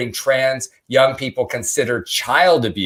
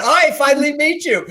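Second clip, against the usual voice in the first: much higher, giggling gush; fangirling. Retold or imitated. Fan meeting Brad Pitt at last, giggling high squeal. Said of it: "she was fangirling over Brad".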